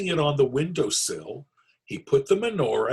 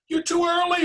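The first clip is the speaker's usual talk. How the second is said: very high panicked shriek; 4 dB louder than usual. Acted. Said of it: "Herschel shrieked".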